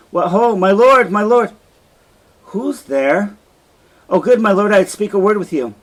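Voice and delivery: high, loud calls at the door; calling out. Acted. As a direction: shout through the door.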